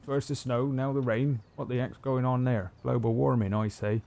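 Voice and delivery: lightly voiced grumbling old farmer. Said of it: "some old farmer".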